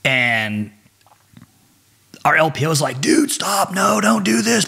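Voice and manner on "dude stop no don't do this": high, drawn-out mock plea; pleading imitation. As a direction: imitate someone begging him to stop. LPO pleads to stop chase.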